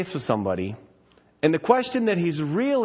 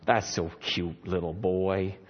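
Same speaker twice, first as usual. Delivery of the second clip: patronizing aww; 10 semitones lower, 4 dB quieter, slower than usual. Retold or imitated. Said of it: "And I'm like".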